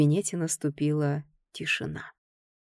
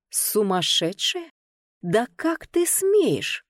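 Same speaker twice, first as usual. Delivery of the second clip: higher, slow outraged cry; mock yell, voice shooting up in outrage; 4 semitones higher, much slower than usual. Heroine crawling out, yelling at Ganer for calling her mad. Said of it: "завопила я".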